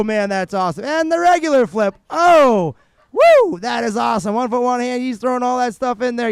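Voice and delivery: high, loud, thrilled sports call; live play-by-play. Real, not acted. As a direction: shout the tricks as they land, stretching the big moments. Calls a successful run live.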